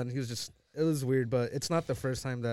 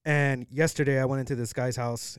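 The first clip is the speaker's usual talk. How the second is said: ordinary talk, nothing marked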